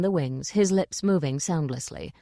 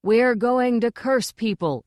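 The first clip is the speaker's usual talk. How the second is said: higher, drawn-out group chant; slow, raised chorus shout; 5 semitones higher, 4 dB louder, slower than usual. A narrator's reading. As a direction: chant it out like obedient recruits. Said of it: "they chorused".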